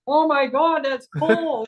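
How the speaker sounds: loud, high-pitched horrified exclamation; hammed-up shocked outburst; mock shock. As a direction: overreact hugely, voice shooting up. Acted shocked reaction to temperature.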